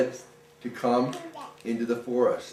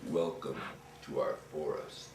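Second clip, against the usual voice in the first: head troll welcoming the ghost, deep and soft; 8 semitones lower, 12 dB quieter than usual. Retold or imitated. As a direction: greet the ghost like a gentle giant troll. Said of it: "'Cause he said".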